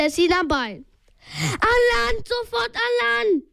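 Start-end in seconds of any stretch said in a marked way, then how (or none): 1.5-3.4 high-pitched, urgent narrated yell. A narrator's reading. Peter urging his friends out of the water, yelling. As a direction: yell the order high, without full volume. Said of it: "brüllte Peter".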